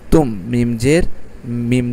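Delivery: normal talking voice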